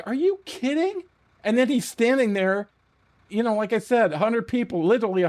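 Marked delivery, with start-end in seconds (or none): 0.0-1.0 much higher, incredulous are you kidding; mock disbelief at the nurse; disbelieving quote. Watching the nurse fold the box, incredulous and high. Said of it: "I'm like".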